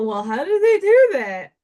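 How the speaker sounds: loud, high-pitched wondering question; mock puzzlement. Acting out puzzled viewers asking how it was done, mock puzzlement. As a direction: exclaim it as the baffled crowd, acting it out. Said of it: "And then we go".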